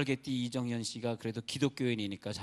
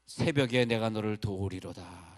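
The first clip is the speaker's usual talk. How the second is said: scripture recitation; 3 semitones lower, 5 dB louder, slower than usual. Acted. Quoting scripture.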